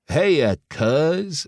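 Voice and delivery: slow, high Texas drawl; exaggerated Texas twang. A narrator's reading. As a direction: draw it out in a thick Texas twang. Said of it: "drawled with an exaggerated Texas twang".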